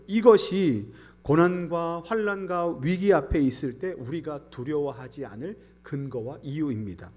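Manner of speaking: ordinary delivery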